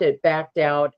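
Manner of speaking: usual speaking voice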